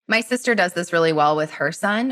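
ordinary delivery

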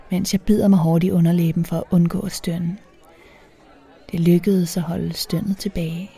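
usual speaking voice

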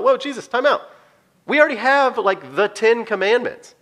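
mock panic, slowing on the Ten Commandments; alarmed disciples. Disciple stopping Jesus over a new commandment, hammed-up alarm. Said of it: "the disciples probably thought".

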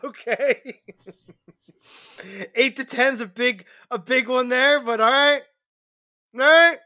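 high-pitched, barely contained amusement; holding back laughter. Real, not acted. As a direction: concede it while fighting a real laugh. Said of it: "I'm trying not to laugh".

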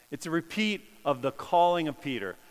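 ordinary talk

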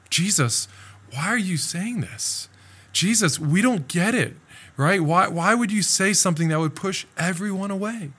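high, pleading disciples; confused disciples. Acted. Disciples pleading with Jesus, high and confused.

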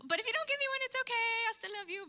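high, singsong plea to the Lord; mock-sweet pleading. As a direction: put on a high little it's-okay voice. Retold bargaining prayer to God.

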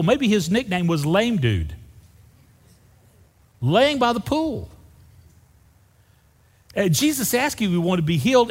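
usual speaking voice

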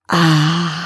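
long breathy sigh of ah; exhaled ah. Shown as a demonstration. Host exhales with listeners, long sighing ah. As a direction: let the breath pour out on ah. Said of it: "And then exhale".

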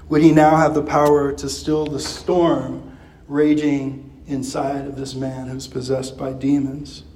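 usual speaking voice

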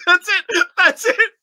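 high laughing through words; cracking up while talking. Real, not acted. Laughing at short verse.

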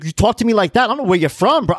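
put-on high, heated street-accent challenge; tough accent. Mover going hard at the neighbor, heated tough accent. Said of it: "I'm got an accent now".

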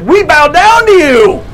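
high, loud and slow overjoyed cry; excited brothers. Acted. Brothers hailing their long-lost brother, overjoyed. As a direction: brothers bowing to Joseph, shouting hallelujah in joy. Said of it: "Pretty excited about it".

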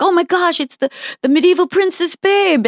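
a hint of a fan's squeal; excited fans. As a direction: gush like a starstruck fan. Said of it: "And they're like".

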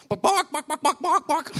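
high, loud chicken squawks; mimicking a chicken's bok bok; chicken clucking. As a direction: cluck like a startled hen. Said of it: "the woman went".

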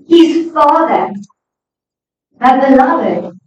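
usual speaking voice

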